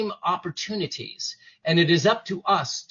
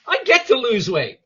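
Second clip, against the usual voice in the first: louder, higher, punching get; eager reframe; 5 semitones higher, 5 dB louder than usual. Shown as a demonstration. Coach showing the positive self-talk, eager and louder. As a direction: say it like good news. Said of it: "as opposed to".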